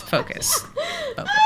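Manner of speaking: giggling through it; laughing at herself. Real, not acted. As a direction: try to refocus while cracking up. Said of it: "But focus".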